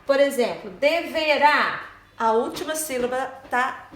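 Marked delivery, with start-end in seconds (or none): 0.7-1.8 "deverá" slow, strong on final RÁ; drawn-out "deverá" peaking on RÁ; stressed syllable. Shown as a demonstration. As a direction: land on the last syllable of "deverá". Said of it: "é mais forte".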